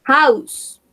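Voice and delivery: teacher modelling the word House. Shown as a demonstration. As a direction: call House out clearly to the class. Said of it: "modelling words to a class".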